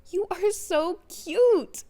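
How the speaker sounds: slow, high, sing-song coo; a creepy, playful girl teasing him; giggling. Acted. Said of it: "she giggled".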